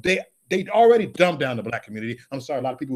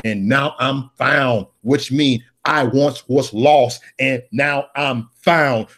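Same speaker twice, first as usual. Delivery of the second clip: slow quote; 4 semitones lower, much slower than usual. Acted. Quoting hymn lines slowly.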